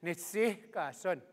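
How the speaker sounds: "Nitsiikasun" slow and soft, with a breathy H before "kasun". Shown as a demonstration. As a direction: draw out "Nitsiikasun" gently, breathing the H. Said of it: "Now let's slow it down".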